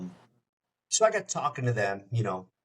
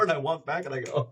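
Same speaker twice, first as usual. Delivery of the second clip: ordinary delivery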